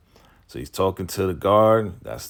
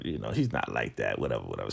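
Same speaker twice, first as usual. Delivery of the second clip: dismissive; 9 semitones lower, 7 dB quieter, much faster than usual. Retold or imitated. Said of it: "lying through her teeth".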